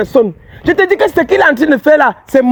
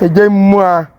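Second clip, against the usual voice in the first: mocking; 6 semitones lower, much slower than usual. Healer mocks the girl's plea.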